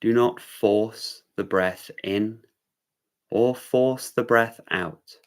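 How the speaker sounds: unhurried meditation-teacher delivery; guided meditation. Real, not acted. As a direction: guide them gently into awareness, leaving room between phrases.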